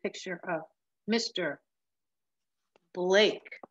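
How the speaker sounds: ordinary delivery, nothing marked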